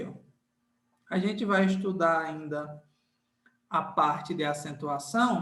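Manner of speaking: usual speaking voice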